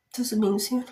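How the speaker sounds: hiccup breaking the words. Real, not acted. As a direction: speak through a hiccup. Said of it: "deu soluço, gente, perdão".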